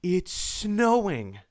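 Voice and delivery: slow reveal. Retold or imitated. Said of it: "And she goes".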